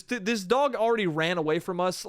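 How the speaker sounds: ordinary delivery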